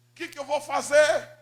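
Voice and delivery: loud, high cry of despair; desperate cry. Retold or imitated. Son asking himself about his future, desperate outcry. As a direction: cry out the question at high pitch.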